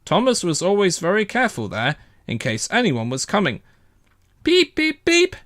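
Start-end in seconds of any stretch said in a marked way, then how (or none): 4.4-5.4 high bright beep-beep; whistle toots. A narrator's reading. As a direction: toot like a little engine's whistle. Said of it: "he whistled".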